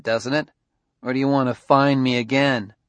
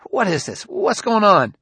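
high-pitched, rising agitation; startled, getting louder on the second question; alarmed; 10 semitones higher, 4 dB louder than usual. Acted. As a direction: demand answers in mounting alarm. Suspect alarmed as robot approaches.

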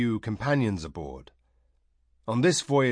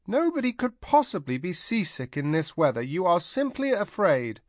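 stern scolding; 9 semitones higher than usual. A narrator's reading. Tanar scolds the princess.

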